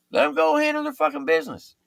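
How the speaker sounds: defiant exclaim. Retold or imitated. Sergeant defending the fight.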